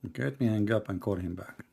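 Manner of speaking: usual speaking voice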